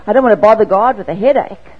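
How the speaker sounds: lightly mocking our own reluctance; mock protest. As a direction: play up the silly excuse loudly. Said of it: "You laugh".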